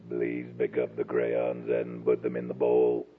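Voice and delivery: very low, slow, mechanical monotone; robot voice. Pretend. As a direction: drone it out like a toy robot. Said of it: "My mama ain't never seen a robot".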